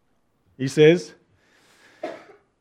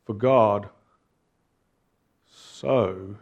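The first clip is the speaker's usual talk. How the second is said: each word set apart by long pauses, slow and lower; slow emphatic reading; 6 semitones lower, 4 dB quieter, slower than usual. Shown as a demonstration. Preacher shows the congregation how to read a verse, slowly and emphatically. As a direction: lean on every word of the verse, one at a time. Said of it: "emphasize each word slowly as you read it".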